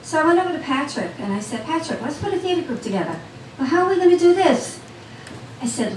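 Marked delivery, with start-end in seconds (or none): 1.7-3.2 quick, higher-pitched eager suggestion; a retold burst of enthusiasm, fast and high. Telling Patrick about her theater plan, eager and quick. 3.6-4.8 high, loud and fast retold question; playing Patrick, caught off guard, pitched up; imitating surprised doubt. Patrick questioning her theater plan, surprised and high.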